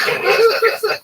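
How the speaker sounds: high, loud startled oh; sudden high yelp of surprise. Retold or imitated. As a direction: jolt awake with a high oh. Voicing the guy waking from fart.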